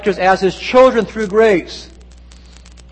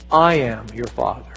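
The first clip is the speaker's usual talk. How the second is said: low, soft, steady reassurance; calm divine answer; 8 semitones lower, 5 dB quieter than usual. Acted. God's voice answering the weeping woman, calm and low. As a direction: answer the cry gently, pitched well down.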